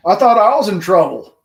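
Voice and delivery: loud, high laughing relief; startled relief. Real, not acted. To Brandon after his sudden shout, loud relieved laugh. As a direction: blurt it out half laughing.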